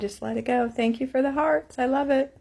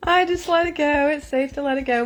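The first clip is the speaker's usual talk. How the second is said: still laughing; 4 semitones higher than usual. Real, not acted. Laughing after silly animal noises.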